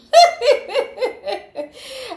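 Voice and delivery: loud, very high cackle; laughing. Real, not acted. Reader laughing at own complaint, high cackle. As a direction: burst into a squeaky laugh.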